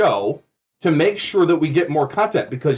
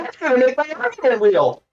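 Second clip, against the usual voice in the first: higher mocking put-on voice; 4 semitones higher than usual. Caller ridiculing another's way of talking, mocking put-on voice. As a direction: mimic the other caller's affected talk. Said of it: "if I talk a certain way".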